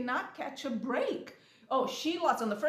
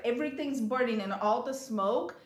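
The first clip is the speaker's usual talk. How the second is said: ordinary talk, nothing marked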